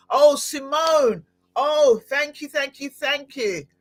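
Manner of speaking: high, drawn-out delighted greeting. Real, not acted. Host spotting a listener in the comments, delighted. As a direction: gush thanks on a lifted pitch.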